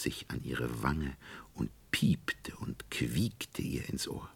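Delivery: slow, eerie whispered narration; whispering. A narrator's reading. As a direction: whisper it creepily. Creepy night narration.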